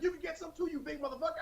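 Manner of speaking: high, strained mock-mom voice, held down; mom's threat. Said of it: "She was like".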